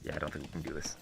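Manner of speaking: talking through a helmet; muffled, behind a visor. Real, not acted. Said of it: "I'll cast with a muffled voice".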